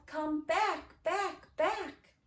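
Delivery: lightly voiced child calling. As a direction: call after drifting balloons. Child calling balloons back in rhyme.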